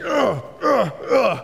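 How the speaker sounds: high strained grunts of a big man; mock effortful grunting; straining grunts. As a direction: grunt like you're stuck under a table. Wrestling bear of a man grunting.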